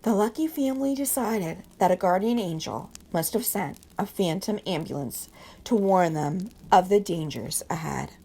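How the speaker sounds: ordinary delivery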